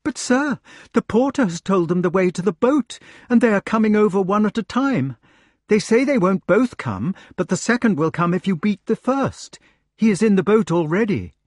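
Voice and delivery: high-pitched gentlewoman with urgent news; agitated gentlewoman. A narrator's reading. Gentlewoman reporting waiting knights to her lady, agitated. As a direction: voice a flustered messenger, pitched well up.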